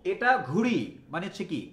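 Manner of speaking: normal talking voice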